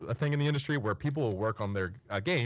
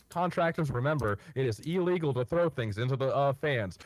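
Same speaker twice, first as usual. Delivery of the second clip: higher-pitched imitation of a PA announcer; loudspeaker announcement; 5 semitones higher, 6 dB louder than usual. Loudspeaker warning contractors about throwing things, imitated announcement. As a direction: read out a warning like the event loudspeaker. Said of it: "I hear on the loudspeaker".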